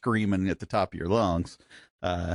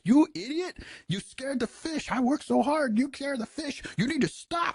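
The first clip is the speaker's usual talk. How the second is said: high-pitched mock rant in a Bahamian accent; scolding Bahamian accent; 3 dB quieter than usual. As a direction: imitate an exasperated Bahamian fishing guide's accent. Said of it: "they, like, yell at you".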